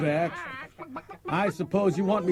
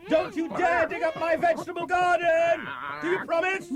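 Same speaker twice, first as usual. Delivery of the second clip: raised voice calling across the yard; calling to the hens; 10 semitones higher than usual. Acted. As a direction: call out firmly to the hens.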